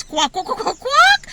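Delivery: quacks rising to a high, loud shriek; mock quacking. Classmates making chicken noises at the girl, quacking building to a shriek. Imitating kids' noise again.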